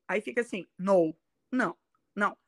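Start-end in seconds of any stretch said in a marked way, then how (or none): 0.8-1.1 "no" with a short Portuguese-style ou; unstretched "no" with Portuguese vowel; Portuguese o sound. Shown as a demonstration. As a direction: say "no" briefly, as a Portuguese ou. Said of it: "é o som do português".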